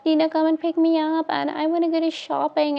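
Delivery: high, pushy whining voice; mock whiny demand. Friend mocking a girl demanding a ride, high whine. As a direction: pitch it up like a spoiled brat.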